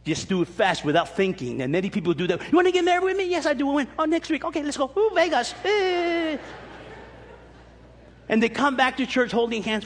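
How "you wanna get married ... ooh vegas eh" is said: higher-pitched mock lovebirds' exchange; lightly hammed-up eager couple, higher voice; impulsive couple. Acting out a rash couple.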